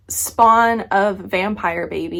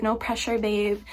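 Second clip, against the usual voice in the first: softer, gentle no pressure babe; soft reassurance; 6 dB quieter than usual. Retold or imitated. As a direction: ease her with a soft repeat. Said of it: "they're like".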